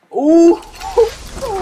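very high, loud whoop breaking into laughter; giddy squeal of joy; thrilled whoop. Real, not acted. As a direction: whoop with delight, then laugh. Thrilled by a favourite skin appearing.

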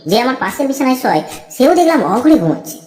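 ordinary delivery

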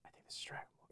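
hushed secret whisper. Retold or imitated. Telling the interviewer his hunch about the role, whispered. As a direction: breathe it like a secret guess.

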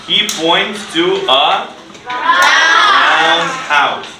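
slow emphatic answer. Shown as a demonstration. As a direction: call out the answer slowly for the class. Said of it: "what does Abby point to?".

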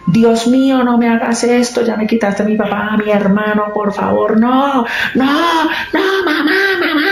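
high, pleading mock scream to God; retold screams. Paola crying out to God over her mother, imitated high pleading scream. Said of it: "Cuando oigo a Paola en un grito".

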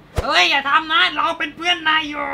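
high, loud mock-dramatic plea; dramatic pleading. Chucky begging Andy not to burn him, dramatic pleading. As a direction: wail it like a doll begging for mercy. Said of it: "กำลังดราม่าแบบ".

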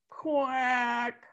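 a lightly voiced tired duck; weary quack. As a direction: quack with fading energy. Duck answers wearily.

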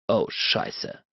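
breathless whispered oh no; whispered curse. A narrator's reading. Bennett realises he is caught, whispered curse. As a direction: whisper it in sudden dread. Narrator's dismayed inner curse.

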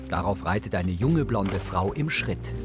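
usual speaking voice

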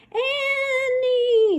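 high, loud, drawn-out screech of the name; screaming raccoon. A narrator's reading. Raccoon screaming at Francine on the roof, high drawn-out screech. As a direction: shriek it long and high like a wild animal. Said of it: "screamed the raccoon".

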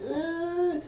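high whimpering ugh; whiny groan. Retold or imitated. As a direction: let out a fake cry. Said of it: "I was like".

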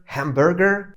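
hamburger said American, r sounded; American accent. Shown as a demonstration. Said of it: "Typowy Amerykanin powie".

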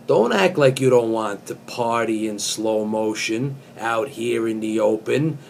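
usual speaking voice

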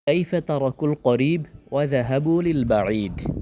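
usual speaking voice

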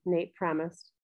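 ordinary delivery, nothing marked

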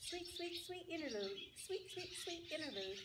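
high, soft sing-song birdcall; light trilling bird voice; birdsong. Acted. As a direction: chirp it like a small songbird. Bird song quoted in the story.

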